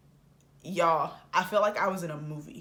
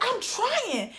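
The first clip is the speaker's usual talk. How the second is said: high whiny retort; exasperated high-pitched whine, acted out; flustered protest. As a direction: squeal back defensively as the harried friend. Friend's retold flustered answer.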